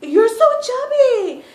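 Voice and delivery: very high, slow squealing mock; drawn-out shrill relative impression; loud high mock shock. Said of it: "it's like".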